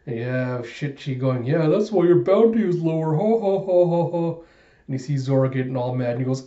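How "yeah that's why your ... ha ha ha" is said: higher, louder jeer into fake laughter; taunt, then force out a stagey ha-ha; hammed-up mocking laugh.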